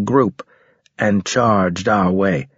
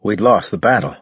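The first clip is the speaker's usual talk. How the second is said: usual speaking voice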